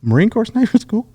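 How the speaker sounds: squeaky-high puzzled question; retold disbelief. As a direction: send it way up, acting out astonishment. Retold disbelief at surprise orders.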